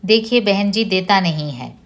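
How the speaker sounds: ordinary delivery, nothing marked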